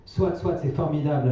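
jeune homme, voix plus aiguë, légèrement moqueuse; narrateur ironique. A narrator's reading. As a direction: dire ça d'un ton faussement enthousiaste. (le petit-fils qui répond à sa grand-mère avec une pointe d'ironie)

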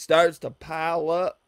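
mocking echo. The friend teasing her pile-up line, loud mocking echo.

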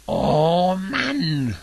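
lautes, höheres genervtes Stöhnen; gestöhnt. Acted. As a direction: klagt laut auf wie ein geschlagener Schurke. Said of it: "stöhnte Bowser". (Bowser stöhnt laut und genervt auf)

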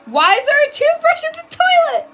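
very high, very loud shrieking; appalled screaming. Real, not acted. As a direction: shriek in disbelief at a gross discovery. Appalled discovery in game.

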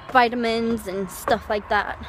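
normal talking voice

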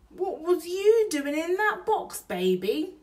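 hammed-up burglar talking to a baby. Bill questions the baby.

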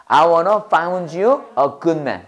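very slow, each word spaced out; slow model sentence. Shown as a demonstration. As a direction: say it slowly for the class to repeat. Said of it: "해봐".

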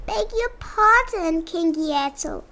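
high pleading little voice; small turtle voice. A narrator's reading.

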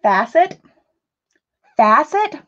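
"Facet" said as fa-set, asking; trying a pronunciation. Shown as a demonstration. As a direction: test "Facet" with a questioning rise. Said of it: "Like that?".

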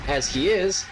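ordinary talk, nothing marked

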